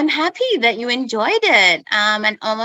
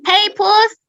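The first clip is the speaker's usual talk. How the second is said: calling the cat; 11 semitones higher, 4 dB louder than usual. Real, not acted.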